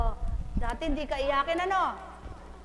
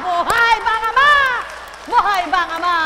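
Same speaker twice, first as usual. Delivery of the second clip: preacher call; 5 semitones higher, 9 dB louder than usual. Pretend. Preacher call after laughing.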